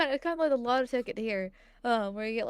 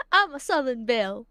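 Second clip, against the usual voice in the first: put-on Southern Belle accent; 6 dB louder than usual. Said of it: "do the accent correctly".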